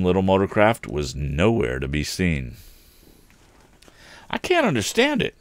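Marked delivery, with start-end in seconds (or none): 4.3-5.3 helpless. A narrator's reading. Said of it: "said Tom helplessly".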